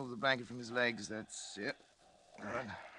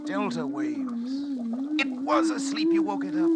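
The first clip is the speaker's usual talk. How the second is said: much higher, louder, exultant; triumphant; 6 dB louder, slower than usual. Acted. Doctor gloating to colleague over living brain, exultant. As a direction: crow over the discovery.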